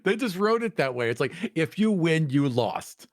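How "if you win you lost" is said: normal talking voice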